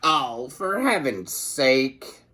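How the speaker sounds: slow, weary mother voice; exasperated mother. A narrator's reading. Mother brushing off her son's story. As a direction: sigh it out like a tired mom. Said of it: "said his mother".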